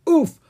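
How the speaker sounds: short, high, loud oof; comic grunt on a hard landing; impact oof. A narrator's reading. Bouncing down a hill.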